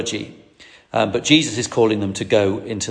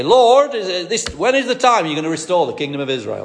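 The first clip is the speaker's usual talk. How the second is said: a broad Yorkshire disciple asking; Yorkshire accent; 6 semitones higher than usual. Acted. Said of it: "I don't know why I'm doing a Yorkshire accent".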